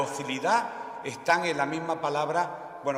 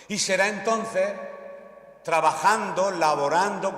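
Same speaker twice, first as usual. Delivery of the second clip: predicación enfática; 6 semitones higher, 6 dB louder than usual. Real, not acted. Predicación culminante.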